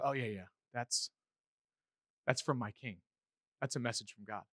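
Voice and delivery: low, soft knowing acknowledgement; soft, low-pitched certainty; quiet recognition. Acted. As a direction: say it hushed, as an older believer who knows. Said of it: "And then when they hear something".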